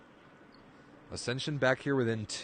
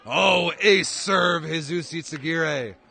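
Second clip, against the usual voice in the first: much higher and louder, very slow; play-by-play thrilled by an ace; excited ace call; 6 semitones higher, 9 dB louder, slower than usual. Real, not acted. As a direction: drag out the ace in delight. Ace called live.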